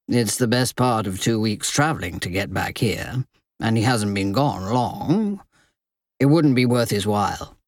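low, calm male voice; level-headed man. Acted. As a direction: explain it steadily. Richard speaks reasonably.

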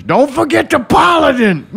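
high, drawn-out mock shout; yelling grandma. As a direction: yell it out slowly like grandma. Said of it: "All I remember my grandma yelling is".

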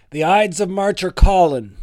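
high, loud lilt verging on Irish; near-Irish accent. Pretend. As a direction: slip playfully toward an Irish accent, projected. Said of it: "very close to doing an Irish accent".

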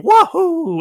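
high shouted Mario "Wahoo!". Retold or imitated. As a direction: belt it out like Mario jumping. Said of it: "Chris Pratt's just gonna be going".

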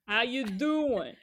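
put-on drawn-out playful New York accent; slow mock New York accent. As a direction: echo a New Yorker's greeting, slowly. Echoing co-host's New York accent.

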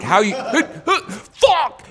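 high, loud strangled outburst; mock panicked shout; frozen actor snapping. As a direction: blank and blow up mid-line. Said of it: "He's like".

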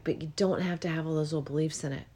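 ordinary talk, nothing marked

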